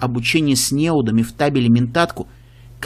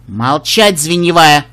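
sharp stern command, loud; stern shout; 6 dB louder, slower than usual. Acted. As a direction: bark the order sternly. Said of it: "строгий голос Бэйнфайр".

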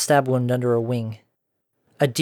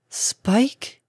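wondering murmur; 10 semitones higher than usual. A narrator's reading.

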